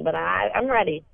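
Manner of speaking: ordinary talk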